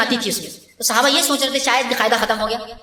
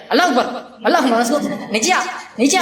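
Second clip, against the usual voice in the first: mock impatient worshippers; 3 semitones higher than usual. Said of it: "اس کو نیچے بلاتے ہیں".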